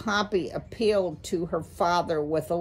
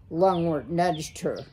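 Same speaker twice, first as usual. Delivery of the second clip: ordinary delivery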